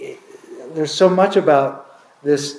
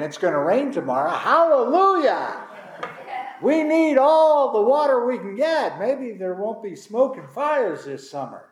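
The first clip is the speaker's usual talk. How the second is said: joyful; 6 semitones higher than usual. Retold or imitated. Drought-weary Oregon crowd hearing of rain, joyful. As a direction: celebrate the rain like good news.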